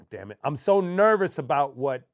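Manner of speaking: ordinary delivery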